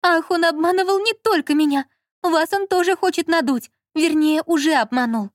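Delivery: high, heated woman's voice; Lola as wronged wife. A narrator's reading. Lola warning the director about her husband, aggrieved.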